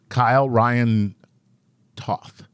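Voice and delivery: each part of Kyle Ryan Toth spaced out slowly; slow careful name reading. Real, not acted. [name] carefully reading a listener's name, slowly. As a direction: sound it out name by name. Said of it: "How easy was that?".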